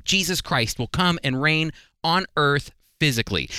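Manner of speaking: ordinary delivery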